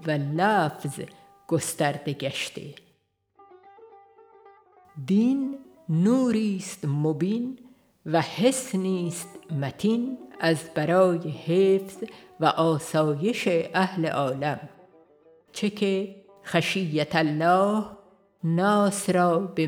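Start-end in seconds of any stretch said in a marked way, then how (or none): none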